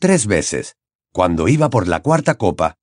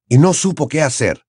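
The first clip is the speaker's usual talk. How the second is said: plain reading voice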